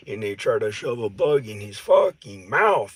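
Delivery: ordinary talk, nothing marked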